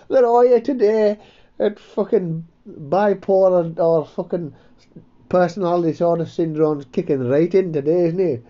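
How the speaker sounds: high, loud and mocking; a squeaky pal teasing his friend; high-pitched cheeky ribbing; [name]'s voice.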